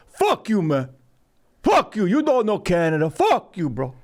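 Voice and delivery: put-on high-pitched indignant heckler in a botched Jamaican accent; weird Jamaican accent. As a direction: imitate an angry Jamaican, accent off. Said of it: "I'm doing a weird accent".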